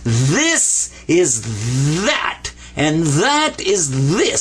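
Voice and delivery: very slow, long buzzing TH on "this" and "that"; heavy TH. Shown as a demonstration. Teacher drills the voiced letter, exaggerated heavy TH on "this" and "that". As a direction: stretch and press the TH in "this" and "that". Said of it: "give it a heavy T H sound".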